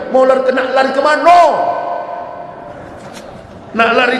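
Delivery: preaching at full force about death; fiery preaching. Acted. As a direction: thunder the warning at the crowd.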